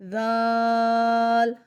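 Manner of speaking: زال held long and loud with a soft z, no buzz; soft zaal. Shown as a demonstration. Teacher showing زال softly for students. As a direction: stretch زال gently without a whistle. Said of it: "زال اور زا میں فرق کرنا ہے".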